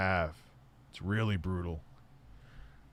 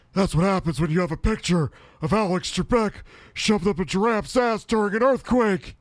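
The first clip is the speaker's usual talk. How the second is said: loud, high ranting voice; hammered-out angry rant in a put-on voice; Tourette's Guy; 9 semitones higher, 5 dB louder than usual. Woken dad ranting at his son, shouted impression. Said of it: "And he's like".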